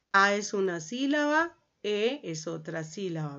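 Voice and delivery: ordinary talk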